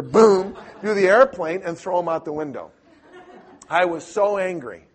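normal talking voice

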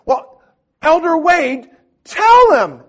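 very high, loud, strained mock voice; mocking companion. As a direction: caricature the pushy companion squealing. Companion prods the elder, mocked.

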